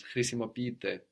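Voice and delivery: usual speaking voice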